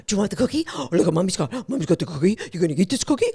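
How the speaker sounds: put-on coaxing, gasping baby talk to the dog; cheerleading owner. Owner cheerleading her dog to take the cookie, sing-song baby talk. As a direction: wheedle the dog like an overeager owner with a treat. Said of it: "And it's cheerleading".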